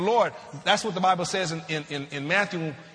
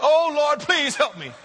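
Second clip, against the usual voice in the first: hammed-up pleading, higher voice; mock desperate cry; 7 semitones higher, 4 dB louder than usual. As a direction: imitate someone begging God in desperation. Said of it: "That cry has desperation in it".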